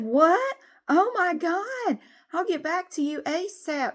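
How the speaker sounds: high, slow, mock-shocked gasp of a voice; surprised office lady. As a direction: squeal it up high, fake shocked. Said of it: "She said".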